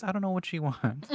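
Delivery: laughing through the words; chuckling while she speaks. Real, not acted. Laughing at hickeys joke.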